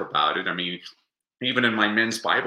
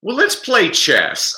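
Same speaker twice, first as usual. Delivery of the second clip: slow, higher and louder, drawn-out punchline; dramatic pause delivery; 11 semitones higher, 7 dB louder, much slower than usual. Retold or imitated. Host retelling the movie scene, slow drawn-out punchline. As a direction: land it slowly after a long beat. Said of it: "the dramatic pause is the best part".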